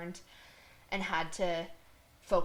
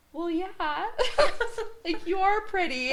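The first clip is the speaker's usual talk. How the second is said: high, louder teasing best friend with a laugh; matter-of-fact friend; 12 semitones higher, 6 dB louder than usual. Retold or imitated. Best friend assuring her she is pretty, high and laughing. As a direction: say it like it's obvious. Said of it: "And she was like".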